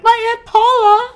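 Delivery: high excited shriek; excited shout. Retold or imitated. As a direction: yell out the name thrilled. Psychic reading caller exclaims.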